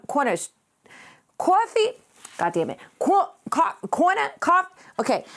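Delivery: normal talking voice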